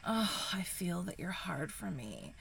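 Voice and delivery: ordinary delivery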